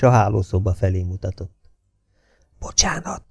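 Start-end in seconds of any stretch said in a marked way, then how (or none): 2.6-3.2 a quick, soft whispered sorry; breathy whisper, no voice; whispered apology. A narrator's reading. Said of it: "suttogta a postás".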